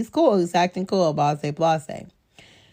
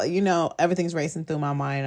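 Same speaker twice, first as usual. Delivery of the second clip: usual speaking voice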